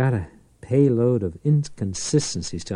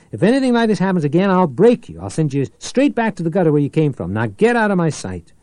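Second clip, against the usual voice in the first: higher, louder, fast threatening tirade; furious threat; 7 semitones higher, 5 dB louder, faster than usual. A narrator's reading. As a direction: tear into him. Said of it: "Powell snapped".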